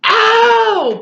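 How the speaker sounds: high, loud yelp of pain; sharp boyish cry of pain; yelling ow. Acted. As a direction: yelp like a stung boy. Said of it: "Patrick kept yelling".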